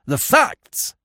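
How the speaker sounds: very high, insistent cry; demanding. A narrator's reading. Investigator insisting on facts from the warder, forcefully demanding. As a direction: repeat it as an explosive demand. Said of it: "he demanded".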